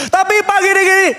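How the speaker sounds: high, loud objecting interruption; retold protest. Engaged congregant objects to the pastor, high and loud. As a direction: burst in with hurried excuses.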